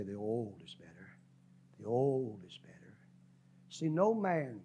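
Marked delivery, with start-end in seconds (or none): 0.0-2.8 low wino mutter. Retold or imitated. Winos preferring their bottle.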